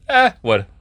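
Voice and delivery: sudden high, loud "what?"; startled. Real, not acted. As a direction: yelp it out in surprise. Startled by game event.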